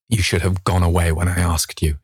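usual speaking voice

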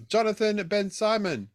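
name read loud and high in caps; shouting out. Pretend. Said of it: "It's sort of shouting out".

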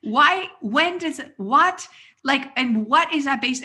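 flustered retold demand for answers; retold bafflement. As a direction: stumble over questions in comic disbelief.

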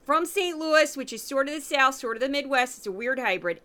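ordinary talk

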